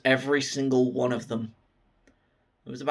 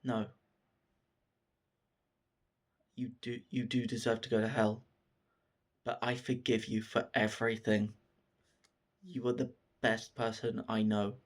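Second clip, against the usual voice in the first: soft, low-key reassurance; gentle forgiveness; 3 semitones lower, 9 dB quieter than usual. Retold or imitated. Dead teacher forgiving his student, gentle forgiveness. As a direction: forgive quietly, with pauses between phrases.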